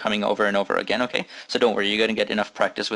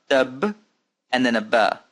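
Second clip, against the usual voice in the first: normal talking voice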